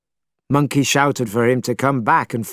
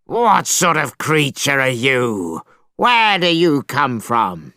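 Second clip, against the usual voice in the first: dragon voice; slower than usual. A narrator's reading. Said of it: "The dragon leapt up and shouted".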